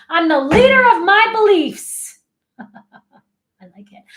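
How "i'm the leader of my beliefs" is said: loud, high rallying cry; real strong. Shown as a demonstration. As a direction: proclaim it at full strength. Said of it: "go in real strong".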